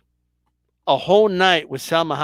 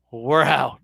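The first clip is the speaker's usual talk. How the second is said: normal talking voice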